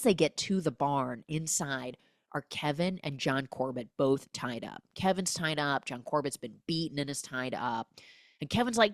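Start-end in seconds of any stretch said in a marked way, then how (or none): none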